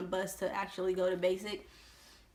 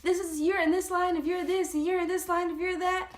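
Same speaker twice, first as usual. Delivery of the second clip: much higher, louder barked instructions; yelled orders; 10 semitones higher, 3 dB louder than usual. Retold or imitated. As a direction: bark the line orders. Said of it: "they were basically yelling at us".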